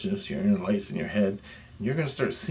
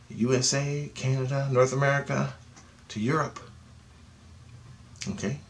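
ordinary talk, nothing marked

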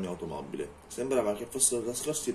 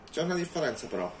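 higher, lighter woman's voice; lightly voiced young woman; heroine voice; 7 semitones higher than usual. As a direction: answer back firmly but lightly. Heroine answers vampire.

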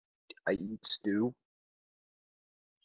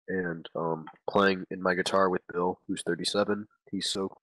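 ordinary talk, nothing marked

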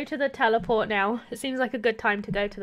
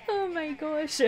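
laughing through it; 4 semitones higher, 6 dB quieter, slower than usual. Real, not acted. Laughing fit after squeaking.

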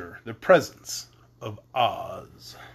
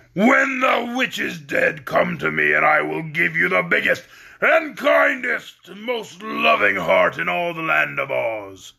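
shouting beast; 3 semitones higher than usual. Acted. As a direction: boom the promise like a monster. Beast promises the Woodman a heart.

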